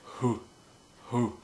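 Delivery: "hoo" pushed out slowly with air from the diaphragm; breathy hoo sound. Shown as a demonstration. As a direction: blow out a slow airy "hoo". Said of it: "just practice the hoo".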